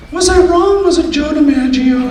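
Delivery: high-pitched talking-dog voice; Skipper. Acted. Thrown-out dog asking his owner, high put-on voice. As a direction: squeak it high as the puzzled dog. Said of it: "Skipper turns to me and he goes".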